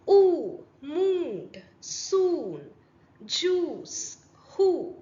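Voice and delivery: ഊ, mood, soon, juice, who said with a long oo; stretched oo vowel. Shown as a demonstration. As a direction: lengthen the oo in every word. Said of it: "ഒരു നീട്ടം ഉണ്ട് അവിടെ". (നീട്ടിയ ഊ ഉച്ചാരണം)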